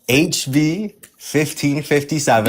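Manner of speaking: slow, careful spelling out; slow and deliberate. Real, not acted. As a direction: spell it out slowly, word by word. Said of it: "We already heard you".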